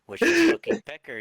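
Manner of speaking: loud, laughing burst on the nickname; can't hold back the laugh. Real, not acted. Host revealing his nickname, laughing loudly. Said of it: "laughing at the nickname".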